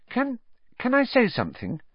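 put-on high, hesitant girl's voice; Hermione, timid. A narrator's reading. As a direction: pipe up timidly to a teacher. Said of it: "said Hermione timidly".

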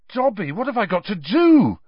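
high, loud, urgent; desperate plea. A narrator's reading. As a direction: beg to be told the answer. Desperate plea to the elf for help.

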